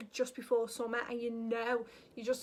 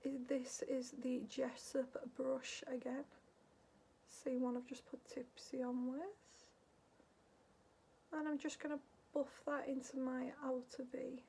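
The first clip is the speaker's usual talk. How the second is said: whispered makeup narration; ASMR tutorial whisper; soft whisper; 5 dB quieter than usual. Acted. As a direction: whisper the steps close to the mic.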